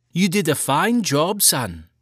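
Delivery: a hint of a proud mother praising; mother praising her son after his reading. A narrator's reading.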